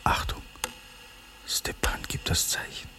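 hushed, breathy warning whisper; urgent whisper. Acted. A conspirator on lookout whispers the signal to his comrades. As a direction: whisper the signal so nobody hears.

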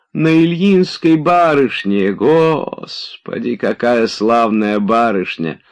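put-on high, loud old servant rambling in remorse and joy; an old man confessing and rejoicing at once; old servant's lament.

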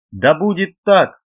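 high ringing proclamation; exclaiming. A narrator's reading. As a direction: cry it out loud and high. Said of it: "воскликнул Гангиэль".

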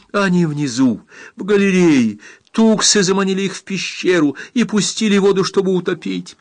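high, pressing report; urgent alarm. A narrator's reading. Girl tells rescuers of the trap, alarmed.

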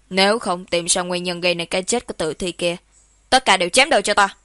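loud, icy official reprimand; commanding and forceful, without warmth. A narrator's reading. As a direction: project stern, cold orders at a subordinate. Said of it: "tôi lại cao giọng lạnh lùng nói".

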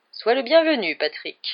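mildly stiff welcome, dropping at end. A narrator's reading. Ghost host receiving his rival, stiff politeness. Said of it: "dit Nick d'un ton raide".

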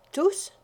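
lightly voiced insistent young woman; insisting. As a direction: press the point with a high questioning lift. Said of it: "dit-elle en insistant".

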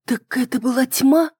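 usual speaking voice

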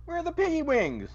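high-pitched frantic demand; shrill frantic demanding. Real, not acted. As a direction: squeal the question in alarm. Favorite dish missing.

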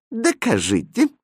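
high, coy princess voice; coquettish command. A narrator's reading. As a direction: playfully order him, high and coy. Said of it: "кокетливо приказала принцесса".

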